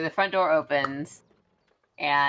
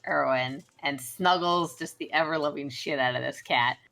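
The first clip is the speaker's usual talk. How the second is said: normal talking voice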